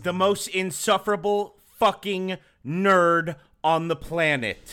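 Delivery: low, deliberate put-down; disdainful verdict. Real, not acted. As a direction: deliver it low, word by word. Low-voiced verdict on the musician.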